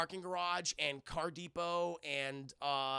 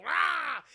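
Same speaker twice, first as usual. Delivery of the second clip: short, loud, high yell; sudden hammed-up holler; mock scream; 9 semitones higher, 8 dB louder than usual. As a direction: imitate a panicked scream. Imitates people in runaway cars.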